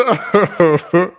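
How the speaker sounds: loud, high cackling laugh; helpless bursts of loud laughing; real laughter. Real, not acted. Storyteller still cracking up at old photo, loud cackle.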